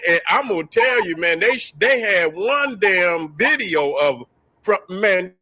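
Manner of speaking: ordinary talk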